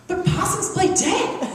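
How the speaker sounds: high, slow, earnest husband voice, laughing through it; quoting him with a laugh in the voice; mock serious husband. Wife imitates husband's earnest fear, high and slow. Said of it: "in all seriousness and goes".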